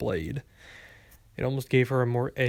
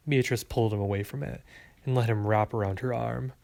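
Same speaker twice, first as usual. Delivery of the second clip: ordinary delivery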